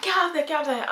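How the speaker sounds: high, urgent repeated command; frantic warning; panicked urging. Retold or imitated. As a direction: push her out the door in alarm. Sister urging her to leave.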